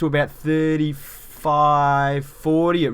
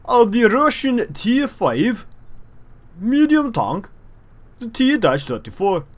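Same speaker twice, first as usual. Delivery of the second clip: over-the-top mock Russian accent, raised pitch; terrible Russian accent; 9 semitones higher than usual. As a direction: play a cheesy Russian tank commander in a thick Russian accent. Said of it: "Apologies for my terrible Russian accent".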